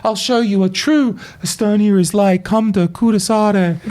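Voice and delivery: a hint of a proud local girl; girlfriend voice. Imagined girlfriend inviting him to Kuressaare, lightly voiced and eager. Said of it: "she's like".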